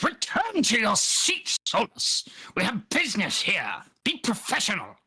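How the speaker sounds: high, commanding rebuke; stern sphinx voice. Acted. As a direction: order her back firmly.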